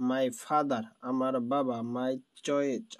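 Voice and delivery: usual speaking voice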